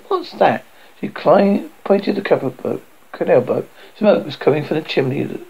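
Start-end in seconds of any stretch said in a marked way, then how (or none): none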